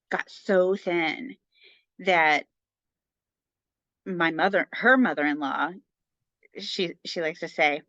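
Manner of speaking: ordinary delivery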